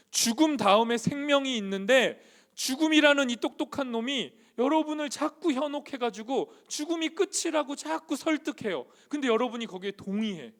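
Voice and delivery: high, loud sermon surge; impassioned preaching. Real, not acted. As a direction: preach it forcefully, driving each phrase. Preaches at full force.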